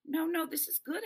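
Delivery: high-pitched, eager reassurance; insistent. Acted. Boy persuading Delphi the porch will do, insistent. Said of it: "I insist".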